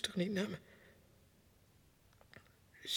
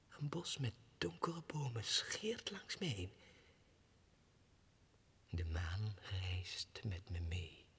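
soft, lower, floating whisper; dreamy whisper; 5 semitones lower, 8 dB quieter than usual. Acted. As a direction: drift away in a whispered vision. Dreamy flight vision. (dromerig gefluisterd)

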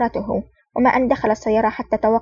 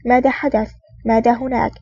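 ordinary delivery

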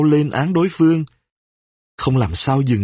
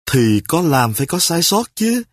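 plain reading voice

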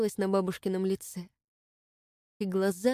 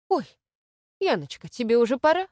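higher and louder, startled; sudden realization; 6 semitones higher, 8 dB louder than usual. A narrator's reading. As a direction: catch yourself all at once. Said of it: "спохватилась бабушка".

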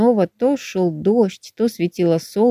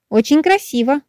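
excited; 7 semitones higher than usual. A narrator's reading. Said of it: "прыгала вокруг белка".

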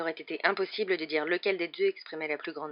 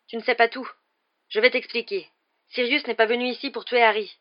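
raised, higher voice, eager to explain; urgent pleading; 6 semitones higher, 8 dB louder than usual. A narrator's reading. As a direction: plead your case before it's too late. Lupin plaide avec urgence. (plaidoyer pressant)

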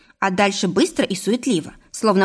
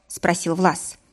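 ordinary delivery

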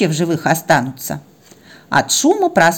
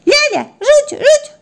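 put-on very high toddler squeak; babbling baby puppy; slower than usual. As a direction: chirp it out in baby talk. Puppy babbles from next room. (писклявый детский лепет)

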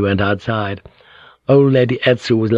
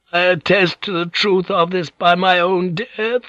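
put-on high, slow, frail old lady; small voice; 9 semitones higher than usual. A narrator's reading. Old lady in great pain seals her testimony, small voice. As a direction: finish the vow slowly in a small, thin voice. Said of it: "she said in a small voice".

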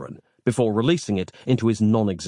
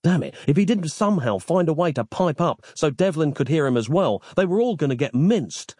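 usual speaking voice

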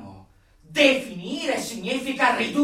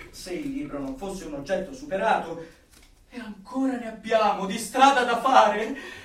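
usual speaking voice

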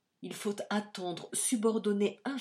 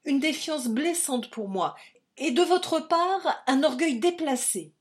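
much higher and louder, building indignation; angry vivacity; 10 semitones higher, 10 dB louder than usual. A narrator's reading. Louise scolding Hégésippe's pride, indignant and rising. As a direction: hurl the reproach, rising to a peak. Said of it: "avec une vivacité qui la faisait ravissante".

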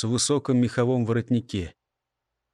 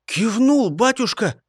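eager outburst; 9 semitones higher than usual. A narrator's reading. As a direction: blurt it out eagerly. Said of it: "встрепенулся младший".

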